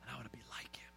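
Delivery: soft whispered longing. Acted. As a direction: breathe it out as a private wish. Quiet closing prayerful wish.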